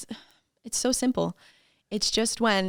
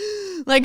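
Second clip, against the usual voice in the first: gasp; 11 semitones higher than usual. Retold or imitated. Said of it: "And I'm just like".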